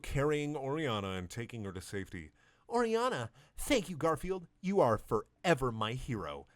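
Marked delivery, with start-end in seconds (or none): 2.7-6.5 lightly voiced grateful sister; grateful woman. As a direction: gush thanks to the hero. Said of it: "said Miranda".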